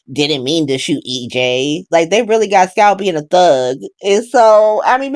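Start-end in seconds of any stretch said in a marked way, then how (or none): 0.1-1.8 slow, softer mock-innocent mumble; imitating Rachel. Quoting a soap character's excuse, slow and hushed. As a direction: play it down like a shrug, slowly. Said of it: "Even Rachel on Days was like".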